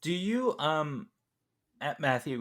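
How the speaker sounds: ordinary delivery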